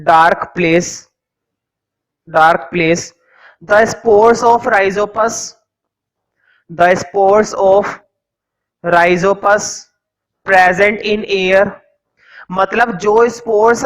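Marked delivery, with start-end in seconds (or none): 0.0-11.7 each word spaced out slowly for note-taking; teacher dictating notes to the class slowly; slow dictation. Acted. Teacher to students copying notes, slow dictation.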